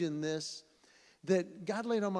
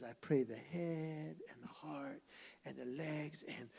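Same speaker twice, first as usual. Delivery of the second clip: put-on softer, slow childlike praying; a little boy copying his dad's bedtime prayer, softer; 9 dB quieter, slower than usual. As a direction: pray gently like a small boy.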